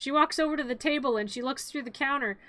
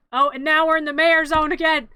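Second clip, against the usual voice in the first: ordinary talk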